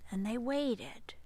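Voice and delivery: ordinary delivery, nothing marked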